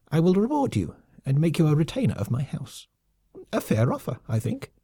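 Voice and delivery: lightly softened high lemming voice; gentler. Acted. Lemming colonel coaxes his foe with an offer, sly and gentler. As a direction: coax him with a sly offer. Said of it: "spoke more gently".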